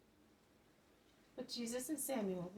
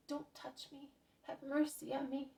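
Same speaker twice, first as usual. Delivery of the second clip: higher pitched pleading cry of a leper; leper's shout; 6 semitones higher than usual. Acted. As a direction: cry out, recoiling in shame. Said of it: "throws himself back on his heels and shouts".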